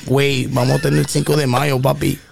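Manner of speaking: lazy Mexican Spanish with güey; change your mouth and go Mexican; laid-back Mexican accent. Shown as a demonstration. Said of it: "and in Mexicano".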